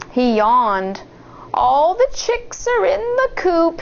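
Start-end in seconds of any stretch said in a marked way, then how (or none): 1.5-3.8 high sleepy fake granny; wolf pretending to be drowsy; higher put-on old woman. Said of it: "he said".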